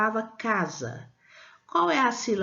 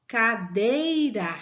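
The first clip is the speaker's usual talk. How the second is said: cadeira said slowly, DEI stretched and strong; stress on middle syllable; much slower than usual. Shown as a demonstration. Teacher showing children the tonic syllable, drawn-out DEI in cadeira. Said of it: "A sílaba tônica é dei".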